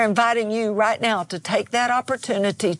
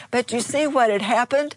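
normal talking voice

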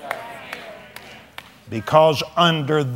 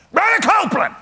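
calling out; 12 semitones higher, faster than usual. Retold or imitated. Man calls out in parking lot.

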